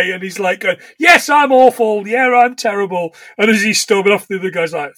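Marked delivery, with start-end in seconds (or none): 1.0-3.1 mock exasperation. Retelling an acted argument.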